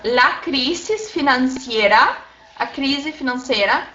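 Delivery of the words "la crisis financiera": slow, measured Spanish example phrase. Shown as a demonstration. Teacher giving students the singular example, slow demonstration. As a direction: say "la crisis financiera" slowly, word by word. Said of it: "Eu digo assim, no singular".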